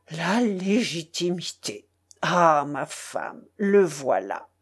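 slow, savoured cry of discovery; exclaimed realisation. A narrator's reading. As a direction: draw the word out as it dawns on you. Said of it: "s'écria le maître de poste en se frappant le front".

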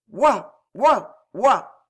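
three loud, separate barks; dog barking. A narrator's reading. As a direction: bark "woof" like a dog. Barks punctuate the minister's speech.

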